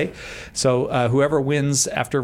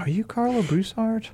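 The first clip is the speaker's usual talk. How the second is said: starstruck fan; 9 semitones higher, 3 dB quieter than usual. Retold or imitated. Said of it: "People are like".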